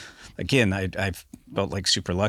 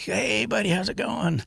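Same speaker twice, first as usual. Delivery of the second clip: much higher, breezy friendly hello; cheery buddy greeting. Retold or imitated. Imitating friend Jody greeting him at his delivery truck, high and chummy. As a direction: sing out a casual hey buddy.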